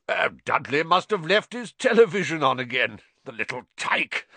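hearty forced-cheerful call; booming cover-up cheer; jovial uncle voice. Acted. Uncle Vernon calls out jovially.

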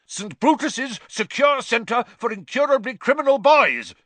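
bellowing. A narrator's reading. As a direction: roar the name out in a panic. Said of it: "bellowed Uncle Vernon".

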